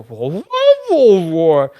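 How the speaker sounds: mock unintelligible masked-villain noises; Bane. Co-host imitating why the film needed subtitles, garbled villain mumble. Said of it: "he would just go".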